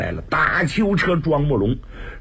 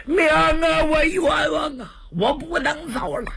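wailing for mother; 12 semitones higher than usual. Acted. Prisoner wails for his mother.

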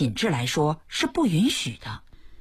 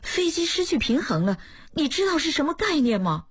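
pitched-up voice of a hurt wife demanding answers; higher wife's voice, upset; 9 semitones higher than usual. A narrator's reading. Wife confronting her pilot husband, pitched-up and upset. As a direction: press him with a raised, wounded voice.

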